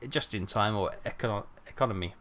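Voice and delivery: ordinary talk, nothing marked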